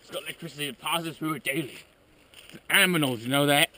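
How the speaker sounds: usual speaking voice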